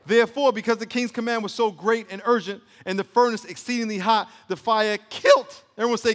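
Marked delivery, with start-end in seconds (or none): 5.1-5.6 kilt pitched up at the end; voice going up on kilt. Real, not acted. Preacher reading how the fire killed, voice rising on kilt. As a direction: let kilt jump high. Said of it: "my voice went up there".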